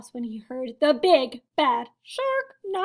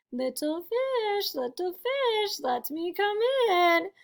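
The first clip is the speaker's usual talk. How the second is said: slow, high singsong villain call; drawn-out taunting storybook shark; big bad shark; 5 semitones higher, slower than usual. A narrator's reading. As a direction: stretch out each phrase, swooping up teasingly. Shark calls at the door.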